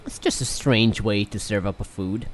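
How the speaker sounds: lisping character voice. Acted. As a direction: speak with a light lisp. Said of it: "Now you have a lisp".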